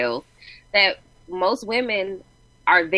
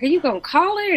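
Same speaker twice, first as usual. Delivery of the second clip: incredulous; 11 semitones higher than usual. Retold or imitated. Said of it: "I was like".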